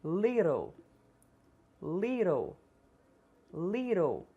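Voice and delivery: little with the t as a quick tap, like lirro; flapped t. Shown as a demonstration. Teacher models little with flapped t. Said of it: "três vezes cada uma".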